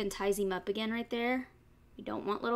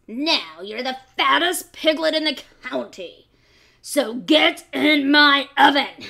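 much louder fox, slowing on the order; commanding fox; 4 semitones higher, 13 dB louder than usual. Acted. Fox commands the piglet into his oven, loud.

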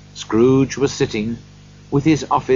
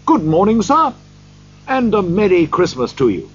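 bright greeting in a raised pitch; cheerful greeting; 6 semitones higher, 4 dB louder than usual. A narrator's reading. Men in the street greet Scrooge, cheerfully. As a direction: hail him cheerfully, higher than usual. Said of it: "said cheerfully to him".

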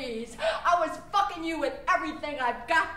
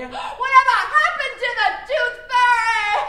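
"Whatever happened to the Tooth Fairy" cried out much higher and louder; shouted lament; 8 semitones higher, 8 dB louder than usual. Acted. Performer mourning lost childhood, crying it out loud. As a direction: wail it to the rafters.

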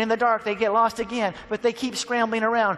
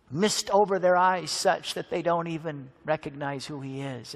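ordinary talk

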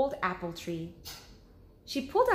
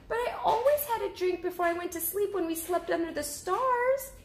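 high, small cub voice; little cub asking; 12 semitones higher than usual. Acted. Cub asking mother about sleeping outside, high little voice. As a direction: ask like a curious little bear cub. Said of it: "said Baby Bear".

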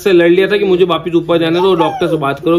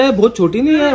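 joking; 7 semitones higher than usual. Retold or imitated. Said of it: "मजाक करने के लिए".

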